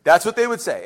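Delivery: ordinary delivery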